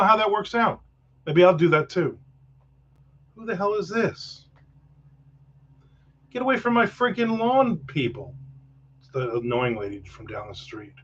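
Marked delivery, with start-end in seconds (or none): 3.4-8.3 a hint of a cranky old man, higher; mock grumpy yell at people on the lawn; grumpy neighbor.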